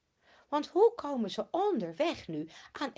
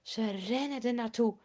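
plain reading voice

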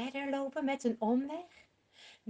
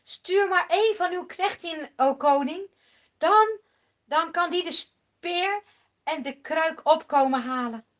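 David calling his reply to Saul; 3 semitones higher, 8 dB louder than usual. Acted. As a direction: shout the answer across to the king. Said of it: "Maar David antwoordt".

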